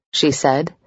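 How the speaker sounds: plain reading voice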